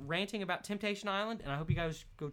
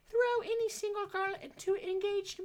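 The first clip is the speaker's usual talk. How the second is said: put-on lather on a silly British-ish accent; a mangled British accent he can't place; unclear put-on accent; 14 semitones higher than usual. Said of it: "I don't even know what kind of accent I'm doing there".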